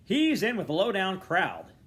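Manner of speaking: loud, high angry snarl. A narrator's reading. Father sneering at his son's friend, snarling loud. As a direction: spit it out with contempt. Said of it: "Mister Dedalus snarled".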